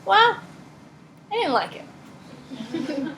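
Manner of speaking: high-starting, very slow, laid-back mock reply; imitating easygoing Cook. Teacher imitating her chill friend after a punch, slow and laid-back. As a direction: play the unbothered friend, dragging it out. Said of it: "and Cook would say".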